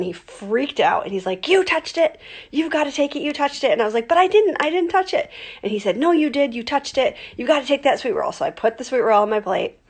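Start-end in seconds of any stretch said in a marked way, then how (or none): 1.5-3.7 high, insistent accusing dad; mock angry dad. Dad scolding his child at the buffet, mock insistent. 4.1-5.3 put-on high, loud childish denial; whiny kid protest. Little girl protesting to her dad, high and whiny. 6.0-8.3 high, insistent accusing dad; mock angry dad. Dad scolding his child at the buffet, mock insistent.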